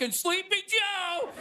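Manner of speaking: high, slow mocking taunt; Trump impression. Trump voiced mid-assassination attempt.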